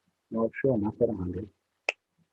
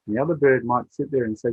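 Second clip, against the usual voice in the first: ordinary delivery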